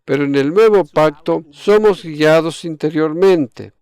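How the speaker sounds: normal talking voice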